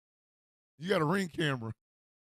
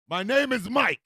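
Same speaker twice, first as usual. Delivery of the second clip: higher, louder indignant insistence; exasperated correction; 5 semitones higher, 6 dB louder than usual. Acted. Mike correcting roommate Todd on his name, exasperated. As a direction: push the name out, fed up.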